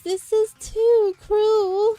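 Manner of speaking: high, loud, drawn-out lament; game character's wail. Acted. Voicing the game's dialogue for viewers, high loud wail. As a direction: wail it out slowly and theatrically.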